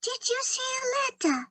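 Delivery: high, quick tiny mouse voice; little mouse voice. Acted. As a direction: squeak it like a small mouse. Mouse character Penny asks.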